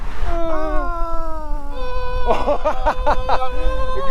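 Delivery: laughing high and giddy; real delighted laughing; high joyful laughter. Real, not acted. Cyclist at the Greek border, laughing high with joy.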